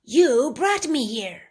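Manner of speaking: anger creature. Acted.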